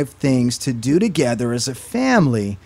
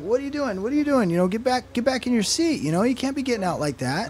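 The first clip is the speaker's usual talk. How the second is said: much higher, mock exasperated scolding; frustrated dad; 10 semitones higher, 4 dB quieter than usual. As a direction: replay a fed-up father snapping at his kid, pitched up. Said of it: "I'm frustrated".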